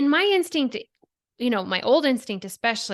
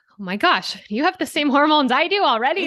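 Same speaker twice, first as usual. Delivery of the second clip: exasperated mom; 6 semitones higher, 5 dB louder than usual. Retold or imitated. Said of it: "sometimes you're like".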